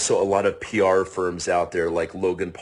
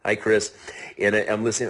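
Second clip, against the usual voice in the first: ordinary talk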